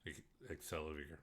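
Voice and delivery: hushed awe. Acted. Reading found label in awe.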